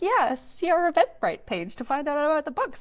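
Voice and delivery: high, eager acted-out answer; high-pitched friendly quote; upbeat reply. Voices book club's eager reply.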